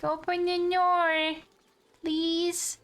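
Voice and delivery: high, begging voice; pleading. Retold or imitated. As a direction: beg sweetly to be let in. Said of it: "They're like".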